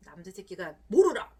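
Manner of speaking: sharp loud bark of a stern adult; scolding adult. Retold or imitated. Parent scolding a crying little boy, loud and sharp. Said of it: "어른들이 뭐라고 얘기하죠?".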